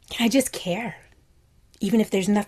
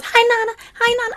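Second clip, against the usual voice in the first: put-on high, louder child's double hello; little niece greeting; 7 dB louder than usual. Niece happily greeting her Nana in a high, loud child voice. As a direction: chirp it twice like an eager little girl. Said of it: "So when she goes".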